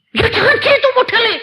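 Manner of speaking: put-on high, complaining young man's voice; whiny son objecting to dad; son protesting. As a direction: pitch way up as the fretting son.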